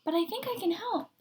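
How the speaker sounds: put-on high sweet old woman; bright. Old woman offering help, bright and high. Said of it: "she said brightly".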